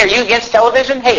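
ordinary talk, nothing marked

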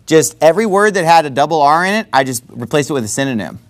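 ordinary talk, nothing marked

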